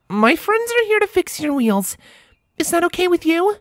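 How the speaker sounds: high, gentle pony voice; Starlight voice. A narrator's reading. Starlight asking the rover's permission, high and gentle. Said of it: "as she gestured".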